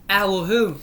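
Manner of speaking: normal talking voice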